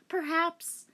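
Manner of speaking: high, coy reply; lightly voiced coy girl.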